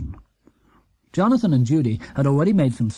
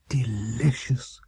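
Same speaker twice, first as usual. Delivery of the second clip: slow, whispered savouring; hushed delight; 3 semitones lower, 5 dB quieter, much slower than usual. Acted. Mr Brown tasting Paddington's stew, slow and whispered. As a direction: breathe the word out like a treat.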